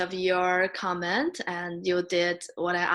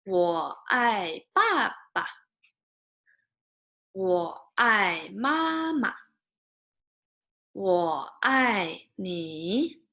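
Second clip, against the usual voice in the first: very slow, each Mandarin word drawn out; slow model reading; much slower than usual. Shown as a demonstration. Teacher wants you to follow along, slow model reading. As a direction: read word by word, full tones, for repeating. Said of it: "We'll read this one more time".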